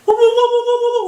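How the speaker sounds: high loud hand-over-mouth war whoop; a hammed-up whooping war cry; mock war whoop. Kid's-show host doing a mock war whoop.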